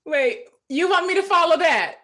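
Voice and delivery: loud, high-pitched astonished protest; playful disbelief. Real, not acted. Next panelist reacting to a fiery speaker, loud disbelief. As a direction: blurt it out, loud and high, incredulous.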